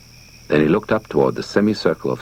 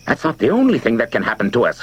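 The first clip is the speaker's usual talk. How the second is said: quick, higher, scared rush of words; frightened, hushed; 9 semitones higher, 3 dB louder, faster than usual. A narrator's reading. Frightened neighbor grabs Steve's arm, fast and scared. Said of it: "in a frightened, hushed voice".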